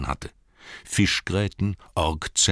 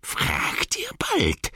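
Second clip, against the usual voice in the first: slow, much higher creature whisper; Gollum's whisper; much slower than usual. Acted. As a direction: hiss it out eagerly. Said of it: "sagte Gollum". (hohes, langsames Gollum-Flüstern)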